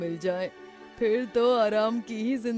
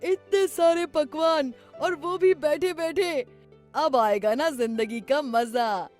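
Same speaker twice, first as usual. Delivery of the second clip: loud, high delighted cry; joyful shout; 6 semitones higher, 7 dB louder than usual. Acted. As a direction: crow with joy over the feast. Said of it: "खुशी से चिल्लाया".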